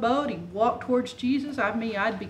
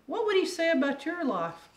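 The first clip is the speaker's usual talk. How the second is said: ordinary talk, nothing marked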